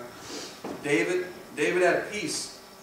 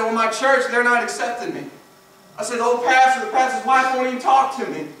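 loud, high retelling of a complaint; raised-voice preaching; 7 semitones higher, 10 dB louder than usual. Retold or imitated. Preacher retelling a sister's hurt over her church, raised-voice preaching. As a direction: build it up into a preacher's cry.

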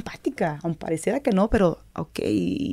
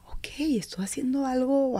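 breathy whispered aside to herself; whispered thought; 5 semitones higher, 9 dB quieter than usual. Retold or imitated. Footballer telling herself she's doing well, in a whisper. Private thought after fan praise.

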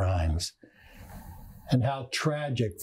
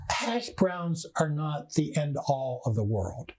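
ordinary talk, nothing marked

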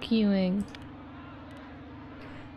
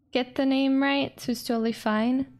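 usual speaking voice

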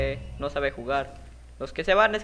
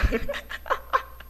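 giggle; 4 dB quieter than usual. Real, not acted. Laughing at the game.

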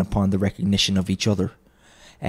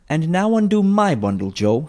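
brazen woman; 8 semitones higher than usual. A narrator's reading. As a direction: chide her for staring. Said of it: "said the first woman".

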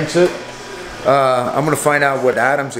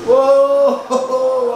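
excited whoa; 11 semitones higher than usual. Real, not acted. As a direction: let out a thrilled whoa. Coming out of the sauna.

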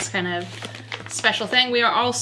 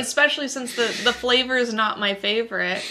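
talking while chewing; mouth partly full; 2 semitones higher than usual. Real, not acted. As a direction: donut held at lips. Seen 1: Woman holds a bitten donut piece at her mouth while talking.

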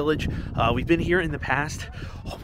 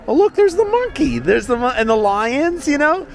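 high, loud delighted squeal; excited; 11 semitones higher, 5 dB louder than usual. Real, not acted. Excited spotting display animals.

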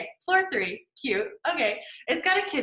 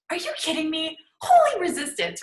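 exasperated; 7 semitones higher, 6 dB louder than usual. Retold or imitated. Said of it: "I'm like".

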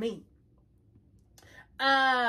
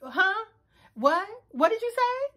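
higher-pitched puzzled asking; mock confusion; 7 semitones higher than usual. Milton struggling to follow her mama's thick accent, confused. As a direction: imitate his baffled, rising questions. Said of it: "Milton kept being like".

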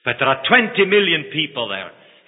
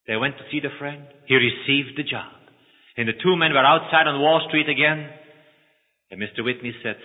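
ordinary talk

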